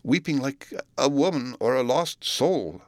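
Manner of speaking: haunted servant describing a dreadful sound; haunted recollection. Acted. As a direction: draw it out with awe and sorrow. Butler recalls the dreadful weeping.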